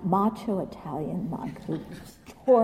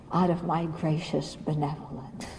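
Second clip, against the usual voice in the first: ordinary delivery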